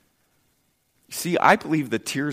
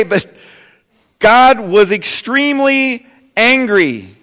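loud, higher, emphatic sermon voice; preaching; 8 semitones higher, 9 dB louder than usual. Acted. Preacher telling of God's anger, loud emphatic preaching. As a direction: thunder the point home.

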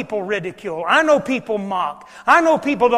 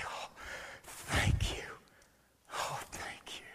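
breathless whisper of gratitude; whispered thanks; 20 dB quieter than usual. Retold or imitated. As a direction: whisper it, overwhelmed with relief. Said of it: "look at Daryl and Tanya and say".

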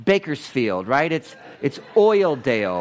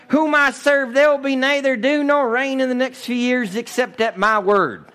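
country bumpkin Elijah; 7 semitones higher than usual. Acted. Elijah from the sticks, warning the king, hammed-up country voice. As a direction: boom it out like a hillbilly preacher. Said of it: "I'm being a guy from New Kuyama".

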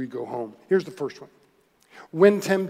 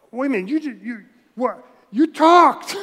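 higher and louder, sputtering; loud stunned disbelief; 5 semitones higher, 6 dB louder than usual. Pretend. Balaam realizing the donkey spoke, loud disbelief. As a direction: shout out the shock that it talked. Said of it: "didn't he at first go".

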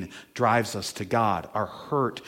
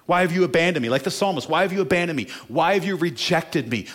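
louder, higher plea of abandonment; a preacher voicing Jesus forsaken, pleading loud; anguished cry; 7 semitones higher, 6 dB louder, faster than usual. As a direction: cry it out to the heavens.

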